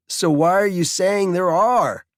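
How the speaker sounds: put-on a know-it-all kid talking back; lightly voiced teenage boy; sweaty teen boy.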